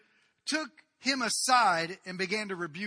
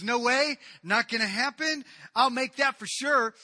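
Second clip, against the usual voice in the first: loud protest; 5 semitones higher, 9 dB louder than usual. Acted. Peter protests loudly.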